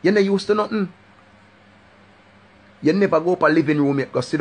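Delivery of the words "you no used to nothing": mocking. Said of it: "the girl a mind me like".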